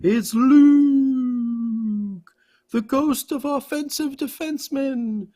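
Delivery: put-on a young defenseman calling out the veteran for his job; voicing Luke. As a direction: tease the veteran in a lifted mock voice. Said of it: "voicing Luke".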